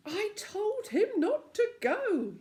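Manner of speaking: high, loud, slow groan of dismay; a fretting Moonface wails that he warned him. Acted. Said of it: "he groaned".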